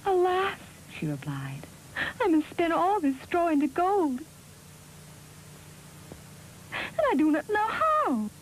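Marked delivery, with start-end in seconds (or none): 0.1-0.6 high, tearful storybook maiden; lamenting maiden. A narrator's reading. Weeping maiden answers the little man, lamenting. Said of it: "As she sat lamenting". 2.2-8.3 high-pitched, tearful maiden; lamenting maiden. A narrator's reading. Maiden tells the little man of her task, lamenting. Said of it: "As she sat lamenting".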